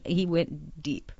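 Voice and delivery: ordinary talk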